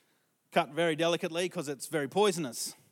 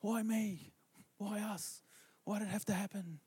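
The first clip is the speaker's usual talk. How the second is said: lament; 7 semitones higher, 5 dB quieter than usual. Retold or imitated. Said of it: "they're like".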